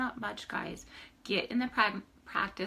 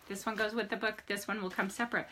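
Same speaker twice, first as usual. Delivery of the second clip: normal talking voice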